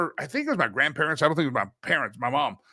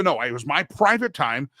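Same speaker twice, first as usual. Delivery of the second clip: normal talking voice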